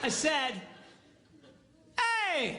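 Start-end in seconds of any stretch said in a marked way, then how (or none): 1.9-2.6 high, drawn-out holler; retold shout to another room; calling out. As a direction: call across the house. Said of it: "She yells back".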